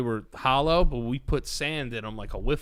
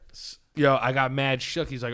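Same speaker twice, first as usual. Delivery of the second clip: usual speaking voice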